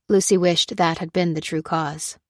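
ordinary delivery

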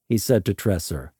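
ordinary delivery

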